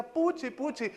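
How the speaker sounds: llamado agudo a una mascota; llamando. Retold or imitated. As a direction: imita a quien llama a su perrita. Esposa llama a la perra. (llamado en voz más alta)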